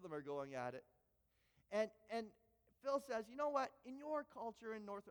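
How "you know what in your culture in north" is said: put-on higher British accent, lecturing; bad put-on British accent, higher; mock British voice before dropping it. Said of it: "I'm not gonna do the British accent anymore because I do a bad job".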